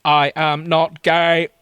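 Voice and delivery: slow, loud, monotone Dalek. Acted. Dalek correcting the heroine, slow Dalek declamation. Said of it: "I specialise in Dalek voices".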